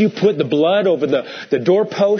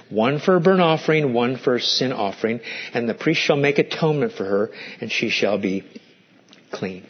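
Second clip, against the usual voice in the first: measured, lower scripture reading voice; 3 semitones lower than usual. Acted. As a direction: read the verses solemnly, lower and steady. Reading Leviticus aloud from scripture.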